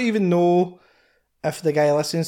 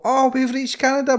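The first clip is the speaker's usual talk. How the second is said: mock excited announcement; 9 semitones higher than usual.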